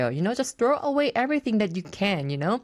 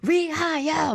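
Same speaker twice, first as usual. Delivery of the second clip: mock toast; 10 semitones higher, slower than usual. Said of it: "막 이러면서".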